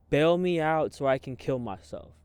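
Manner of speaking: yelled. Retold or imitated. Calling his brother from jail, desperate yell. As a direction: shout it out in despair. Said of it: "I yelled this".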